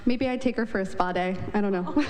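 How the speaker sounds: usual speaking voice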